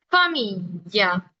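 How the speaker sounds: familia at half speed with a long f; slow marked f. Shown as a demonstration. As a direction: lean on the f in familia slowly. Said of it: "estoy marcando bastante la F".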